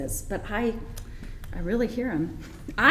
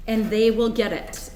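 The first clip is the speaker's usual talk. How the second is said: normal talking voice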